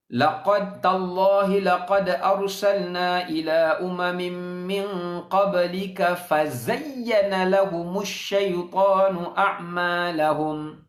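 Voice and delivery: slow, measured Arabic recitation; Quran recitation. Acted. Preacher reciting a Quran verse to the congregation, slow and measured.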